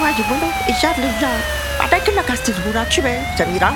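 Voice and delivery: ordinary talk, nothing marked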